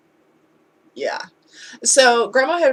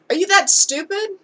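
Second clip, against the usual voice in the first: a sneering question pitched way higher; mock scornful jab; 10 semitones higher, 4 dB louder than usual. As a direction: throw the insult up high and sharp. Parent's insult retold.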